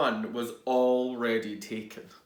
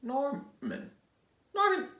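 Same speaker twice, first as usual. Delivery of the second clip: worried snails; 6 dB louder than usual. Acted. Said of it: "asked the snails".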